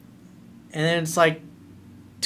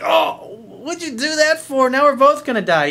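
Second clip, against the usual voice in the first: high, loud, panicked frog voice; 11 semitones higher, 7 dB louder than usual. Acted. Frog stung mid-lake, high loud panicked cry. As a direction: shriek it out like a stung frog losing hope.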